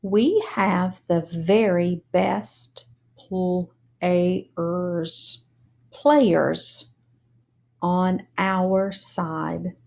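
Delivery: "We have the very best players" read word by word, slow; slow choral reading. Shown as a demonstration. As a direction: read it slowly, one word at a time. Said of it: "Now let's read together".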